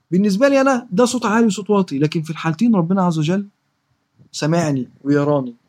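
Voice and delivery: ordinary talk, nothing marked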